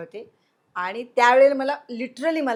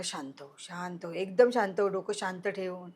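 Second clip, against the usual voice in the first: soothing friend; 4 semitones lower, 7 dB quieter than usual. Retold or imitated. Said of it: "तो म्हणाला".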